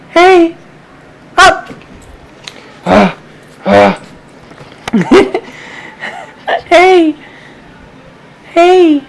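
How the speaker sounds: high, loud short calls; high pet call. Real, not acted. Owner chirps high, loud calls to get the puppy's attention.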